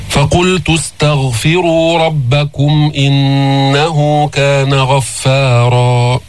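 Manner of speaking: slow, drawn-out tajweed recitation; Quran recitation. Shown as a demonstration. Teacher recites the verse for his students, slow melodic tajweed. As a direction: chant each verse slowly and melodiously.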